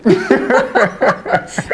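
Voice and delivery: hearty laugh at her own story; laughter. Real, not acted. Retelling her schoolyard challenge, amused laughter. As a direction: burst out laughing.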